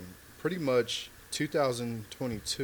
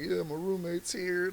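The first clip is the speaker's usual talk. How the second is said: higher, slow, jittery stage voice; a comic playing his nerves, pitched up; nervous first-timer on stage, voice pitched up; 8 semitones higher than usual. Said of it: "'cause I'm nervous".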